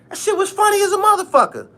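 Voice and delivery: high, quick, emphatic outburst; amused exclamation. Real, not acted. Vlogger recalls a hilarious video, amused exclamation. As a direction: squeal it fast, tickled by the memory.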